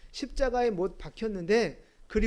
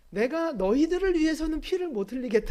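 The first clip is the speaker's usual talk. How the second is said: high, loud mock indignation; exasperated outburst; 6 semitones higher, 8 dB louder than usual. Preacher imagining himself on the cross, exasperated. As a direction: burst out in playful exasperation. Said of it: "분통이 터질까".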